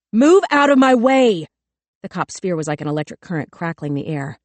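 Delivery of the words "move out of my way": higher, much louder, frantic yelling; panicked scream. A narrator's reading. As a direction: scream it with the gun raised.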